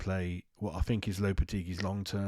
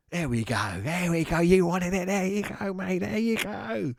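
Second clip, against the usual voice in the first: very high, hammed-up Cockney; exaggerated Cockney; 9 semitones higher, 3 dB louder than usual. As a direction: climb higher and higher in mock Cockney. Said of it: "my Cockney ass-, accent isn't good".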